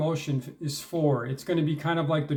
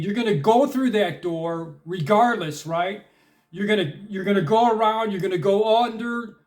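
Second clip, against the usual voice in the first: insistent, pitched up and building in force; forceful determination; 4 semitones higher, 4 dB louder than usual. Shown as a demonstration. Coaching listeners to get through the locked door, forcefully determined. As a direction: drive each option home, refusing to be stopped. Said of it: "made with such determination".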